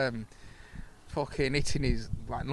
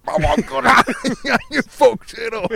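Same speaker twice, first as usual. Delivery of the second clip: high, loud laughing shriek; 12 semitones higher, 13 dB louder, slower than usual. Pretend. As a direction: squeal it out through laughter at the disaster. Imagining a conkers accident.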